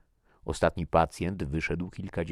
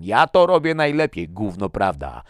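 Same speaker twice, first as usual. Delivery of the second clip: high, loud yell dropping at the end; shouting; 5 semitones higher, 13 dB louder than usual. A narrator's reading. Man insists he coughs best, shouting. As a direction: boast at the top of your voice. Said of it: "krzyknął Tomason".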